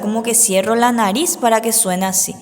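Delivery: ordinary delivery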